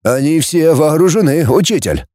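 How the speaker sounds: ordinary delivery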